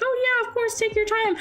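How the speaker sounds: high, squeaky fake-casual voice; nervous chirpy reply. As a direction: sound breezy while panicking inside. Nervous reply to her date's text.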